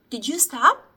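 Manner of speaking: usual speaking voice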